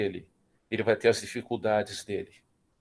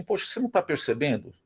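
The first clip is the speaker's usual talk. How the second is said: friend's advice; 7 semitones higher than usual. Retold or imitated. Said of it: "o amigo falou assim".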